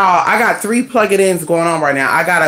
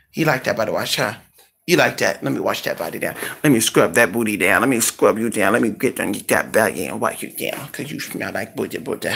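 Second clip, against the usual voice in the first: doggy talk; 7 semitones lower, 5 dB quieter than usual. Real, not acted. Owner cooing while bathing the dog.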